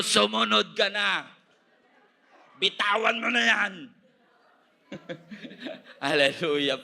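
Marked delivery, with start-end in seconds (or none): 0.0-3.7 raised, forceful exhortation; loud, high preacher's urging; urging loudly. Real, not acted. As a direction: press the command on the congregation. Preacher has congregation repeat the command.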